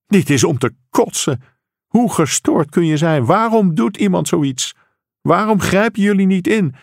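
acted outrage with rising pitch; outraged father. A narrator's reading. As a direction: fire off acted, exasperated questions. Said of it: "schreeuwde hij".

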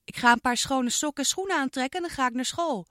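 put-on a girl hurrying off to school; girl Iris.

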